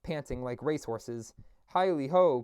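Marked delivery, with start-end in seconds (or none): 1.7-2.4 louder, merry hail; jovial cry. Acted. Old master rousing his apprentices, loud jovial cry. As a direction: whoop it out to the lads. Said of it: "cried old Fezziwig".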